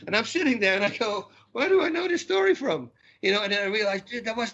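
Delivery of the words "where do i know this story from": sudden high disbelieving question, pitched well up; the penny drops and he can hardly believe it; astonished realization. Retold or imitated. Listening to the theologian, he suddenly recognizes the story, astonished and high. Realizing he has heard it before.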